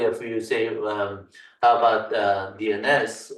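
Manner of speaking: ordinary delivery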